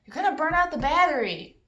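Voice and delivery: put-on a boy yelling at his friend through the window about the drill; calling out. A narrator's reading. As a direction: shout it toward the house opposite. Calling across the street at night.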